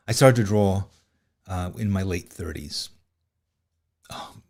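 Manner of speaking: normal talking voice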